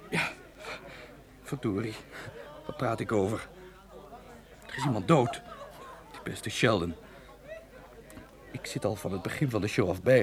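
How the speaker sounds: sudden grief. Acted. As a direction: let the shock of the death sink in. Director sobers, mourning his friend.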